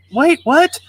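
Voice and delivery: put-on high, loud shocked kid; acted shock, voice shooting way up; stunned disbelief. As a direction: blurt it out as an incredulous boy. Boy is refused his boat.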